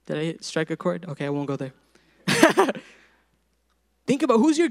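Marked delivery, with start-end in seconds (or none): 2.3-2.8 short, higher-pitched chuckle; laugh. Real, not acted. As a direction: burst briefly into a laugh. Joking aside to congregation.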